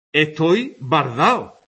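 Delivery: bardao slow, the d between vowels dropped. Shown as a demonstration. Teacher demonstrating dropped intervocalic d. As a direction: drawl out bardao with no d. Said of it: "La pérdida de la D entre vocales".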